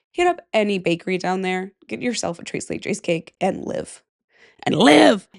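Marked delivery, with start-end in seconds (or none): 4.6-5.3 loud, exultant meme-quote shout; big hammed-up cheer; Ted Lasso. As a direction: belt it out like a rallying cry. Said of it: "That Ted Lasso, like, gif, meme".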